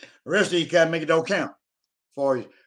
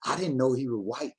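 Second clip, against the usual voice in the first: softer, low confiding aside; mock whisper; 7 semitones lower, 7 dB quieter than usual. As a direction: pretend to whisper, keeping some voice. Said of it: "I'm gonna whisper".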